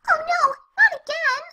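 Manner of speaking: high, loud cry of dismay. Acted. Pony hears of another time loop, cries in dismay. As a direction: wail it like it's happened before.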